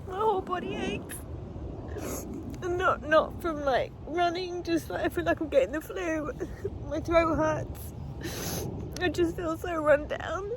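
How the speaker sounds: high, strained voice on the verge of tears; worn out and close to crying; exhausted, tearful. Real, not acted. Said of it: "I'm just so exhausted".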